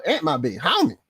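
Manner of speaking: usual speaking voice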